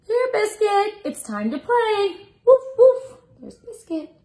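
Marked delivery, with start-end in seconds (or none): 0.0-2.3 high, bright sing-song storybook voice; reading to children. Acted. 2.5-3.2 high, loud playful woof woof; dog bark. Acted. Dog barks in picture book.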